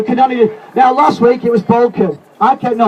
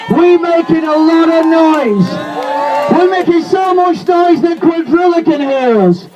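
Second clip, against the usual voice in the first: higher and shouted, slowed on the key lines; fiery rally speech; 8 semitones higher than usual. Real, not acted. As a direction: rouse the crowd at full force.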